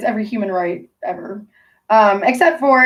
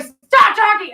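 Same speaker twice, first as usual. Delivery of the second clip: yelled order; 12 semitones higher, 5 dB louder, slower than usual. Retold or imitated. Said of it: "Everybody else is yelling".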